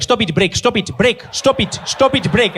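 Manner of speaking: high, insistent imitated father's scolding; scolding Zambian dad. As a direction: keep calling the child off, rising in pitch. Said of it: "his dad was there like".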